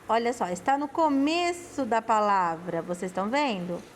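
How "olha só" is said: ordinary talk, nothing marked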